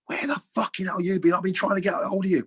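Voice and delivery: high-pitched furious ranting; imitated angry chairman. Chairman bawling at his player down the phone, mock fury. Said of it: "I apologise about my language".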